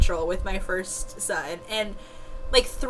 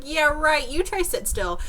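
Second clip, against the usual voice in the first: sarcastic retort; 7 semitones higher than usual. Retold or imitated. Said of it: "I was like".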